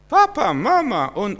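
high, mock-whiny child calling for parents; high wail of a small child. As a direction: imitate a baby crying for mama and papa.

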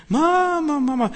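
viel höher und lauter, verzweifeltes Kinderrufen; heulendes Kind. Retold or imitated. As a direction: rufe nach Mama wie ein weinendes Kind. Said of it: "Der heult und Tränen laufen runter". (verzweifeltes Kind, hoch und laut)

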